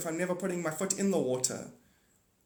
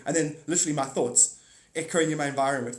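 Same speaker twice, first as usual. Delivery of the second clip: ordinary talk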